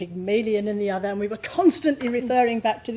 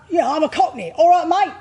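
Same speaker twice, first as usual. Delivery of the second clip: rough, loud Cockney voice pitched higher; Cockney accent; 7 semitones higher, 8 dB louder than usual. Shown as a demonstration. Actress showing how Eliza's Cockney sounds, rough Cockney accent. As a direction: bark it out like a London market Cockney. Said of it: "Cockneys ... have rather husky voices".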